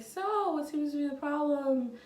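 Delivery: a light take on a routine nurse. Retold or imitated. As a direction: mimic a brisk nurse taking notes. Voicing the nurse's question.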